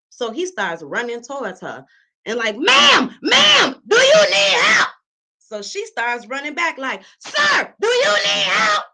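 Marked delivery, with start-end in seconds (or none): 2.6-4.9 high, loud urgent calling; dad calling out. Retold or imitated. 7.2-8.8 high, loud panicky call; alarmed lady. Retold or imitated. Lady calling back, alarmed.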